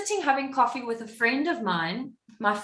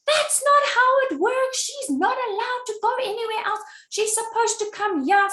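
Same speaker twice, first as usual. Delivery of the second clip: angry nurse; 11 semitones higher than usual. Retold or imitated. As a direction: snap at the caller like an outraged nurse. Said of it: "the nurse goes off on me".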